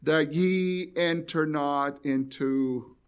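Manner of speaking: very slow, each word spaced out; slow scripture reading. Acted. Preacher reading verse forty-one to his listeners, very slow. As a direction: drag the verse out slowly for weight. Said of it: "it says".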